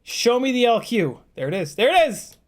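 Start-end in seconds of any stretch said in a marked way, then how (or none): 1.8-2.4 excited. Real, not acted. Drone video link finally appears.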